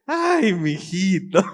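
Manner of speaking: slow, loud mock reproach; exasperated mother. Shown as a demonstration. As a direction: draw it out loudly, as if he's a scatterbrain. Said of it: "Cabeza de chorlito". (mamá exasperada, fuerte y alargado)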